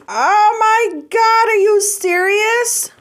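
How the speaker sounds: high, drawn-out astonished gasp of words; delighted disbelief. Real, not acted. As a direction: stretch it out high, thrilled and stunned. Opening an unexpected gift from a friend.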